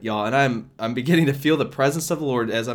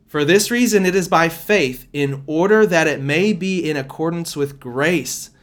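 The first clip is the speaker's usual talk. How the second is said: louder, lifted Bible-reading voice; scripture reading; 3 semitones higher, 5 dB louder than usual. Acted. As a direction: read the verse out with weight to the congregation. Reads scripture aloud.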